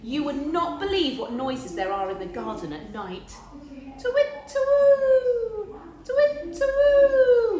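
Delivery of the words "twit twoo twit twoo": very high, loud hooting twit-twoo; owl hoot. Acted. Owl hoots in the night garden, very high and loud. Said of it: "went the owl".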